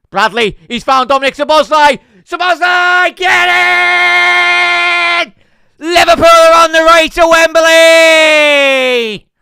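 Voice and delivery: screaming high and loud, full joy; goal celebration scream. Real, not acted. Fan watching live, screaming as his team scores.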